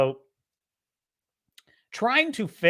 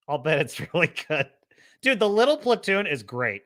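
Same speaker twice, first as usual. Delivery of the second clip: usual speaking voice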